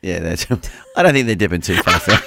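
normal talking voice

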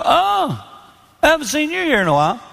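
high, fast fake-surprised greeting; mock surprise. Pastor needles a friend at the gym, high fake surprise. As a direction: act startled to bump into him at the gym. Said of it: "I go up to him and I go".

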